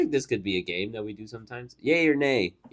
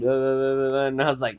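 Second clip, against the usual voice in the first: ordinary talk, nothing marked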